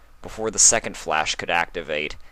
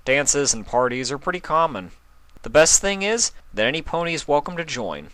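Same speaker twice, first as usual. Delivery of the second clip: plain reading voice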